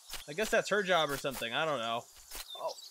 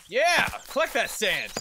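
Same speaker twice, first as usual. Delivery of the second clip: excited cheer; 5 semitones higher, 8 dB louder than usual. Real, not acted. Cheering on game workers.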